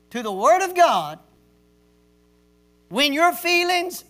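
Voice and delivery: loud fiery preacher; preaching. Acted. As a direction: raise the voice like a pulpit climax. Preaching at full force.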